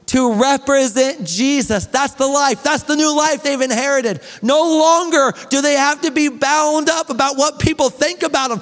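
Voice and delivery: high-pitched, driving sermon delivery; urgent pulpit proclamation; impassioned preaching. Real, not acted. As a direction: preach it with rising fervor. Preaches at full force.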